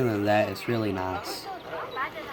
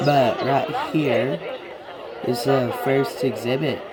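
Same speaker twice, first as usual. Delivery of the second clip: ordinary delivery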